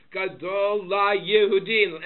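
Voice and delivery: slow, loud weight on "Yehudim"; emphasis on Yehudim. Shown as a demonstration. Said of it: "Emphasis on Yehudim".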